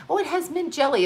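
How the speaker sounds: a hint of a coaxing mother; mimicking mom.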